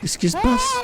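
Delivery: high startled cry of fear; panicked shriek. Real, not acted. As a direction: squeal it in fright. Sudden scare in horror game.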